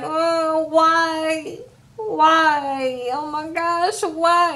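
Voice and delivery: imitating a tearful, melodramatic lament; mock crying. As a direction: wail it, hamming up the heartbreak. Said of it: "just them crying and asking why".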